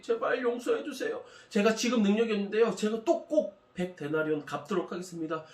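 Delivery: pleading. Acted. Debtor begs his fellow servant for forgiveness, high and pleading. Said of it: "싹싹 빌면서 그 사람에게 용서를 구했어요".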